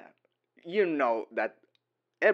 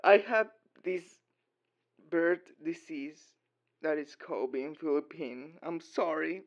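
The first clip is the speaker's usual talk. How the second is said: higher, slowed mock Filipino accent; attempted Philippine English accent; 6 semitones higher, 5 dB louder than usual. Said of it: "I don't know how to do the Philippines English accent".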